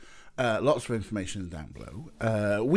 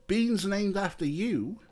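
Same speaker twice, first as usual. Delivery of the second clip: cooing pet talk; 9 semitones higher than usual. Real, not acted. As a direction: fuss over a cute pet. Cooing to the cat.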